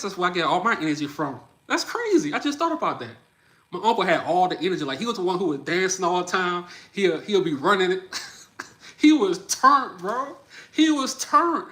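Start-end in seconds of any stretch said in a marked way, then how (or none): 1.7-3.1 much higher, struck by the thought; sudden realization. Real, not acted. Realizing where his own energy comes from, high and surprised. 9.0-11.7 much higher and louder, stretched slow; hyped up. Real, not acted. Bragging to viewers about his preacher uncle, hyped.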